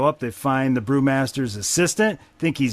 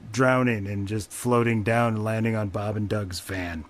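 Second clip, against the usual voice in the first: usual speaking voice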